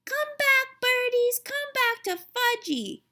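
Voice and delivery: put-on very high, loud little-kid call after birds; toddler screaming. A narrator's reading. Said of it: "was screaming".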